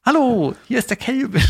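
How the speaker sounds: high, breezy celebrity greeting; chirpy video-message hello; Kelvin. Pretend. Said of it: "dass der Kelvin Schluss macht für mich".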